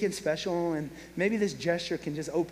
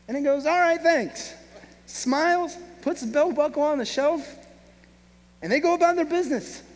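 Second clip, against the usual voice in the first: louder and higher, building sermon delivery; preaching at full force; 9 semitones higher, 6 dB louder than usual. Acted. Preacher telling the congregation the climax, full force. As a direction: drive the story home to the crowd.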